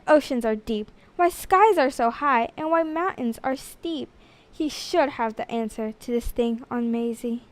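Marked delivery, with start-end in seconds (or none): none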